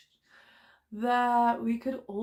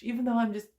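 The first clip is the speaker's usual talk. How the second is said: ordinary talk, nothing marked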